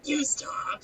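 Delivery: loud, disgusting guttural croak; guttural and disgusting character voice. Shown as a demonstration. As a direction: growl it from the throat, Beetlejuice-style. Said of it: "guttural and disgusting".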